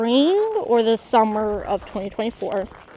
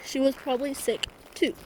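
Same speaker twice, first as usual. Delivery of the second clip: tearful; 8 dB quieter than usual. Real, not acted. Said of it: "sorry, I had to stop".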